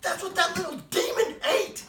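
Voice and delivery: high, loud outraged cry; wife shouting at her husband in outrage; exasperated shout. Retold or imitated. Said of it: "She says".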